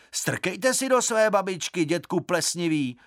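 high-pitched indignant comeback; cheeky retort. A narrator's reading.

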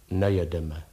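low, hollow sad gentleman. A narrator's reading. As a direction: answer low and mournfully. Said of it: "řekl dutým hlasem smutný pán".

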